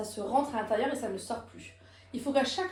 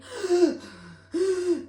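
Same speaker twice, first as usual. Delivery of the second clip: loud audible gasp for air; active inhalation; 7 semitones higher, 6 dB louder than usual. Shown as a demonstration. Coach showing students what not to do when breathing, noisy active inhalation. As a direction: suck the breath in noisily. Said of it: "là, c'est une inspiration active".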